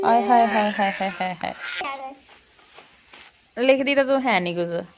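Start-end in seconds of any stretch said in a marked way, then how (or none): 0.0-1.5 theatrical wailing hai hai. Pretend. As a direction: ham up the lament, teasing. Mother teases sulking daughter.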